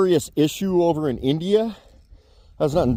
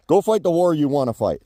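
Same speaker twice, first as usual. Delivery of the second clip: normal talking voice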